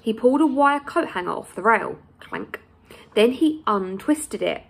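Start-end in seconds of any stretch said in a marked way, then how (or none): 2.2-2.6 low clank sound. Acted. Coat hanger sound effect.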